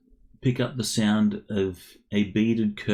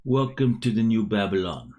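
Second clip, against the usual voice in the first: hammed-up Jamaican accent, orc shopkeeper; bad Jamaican accent; 4 semitones higher, 7 dB louder than usual. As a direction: lay on a clumsy Jamaican lilt. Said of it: "I'll try this in a Jamaican accent, a bad Jamaican accent".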